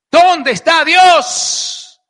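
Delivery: loud, drawn-out cry to heaven; acted desperate shout, vowels stretched; anguished outcry. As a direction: cry it out high and long, demanding an answer. Acts out Joseph's anguished cry to God.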